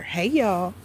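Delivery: ordinary delivery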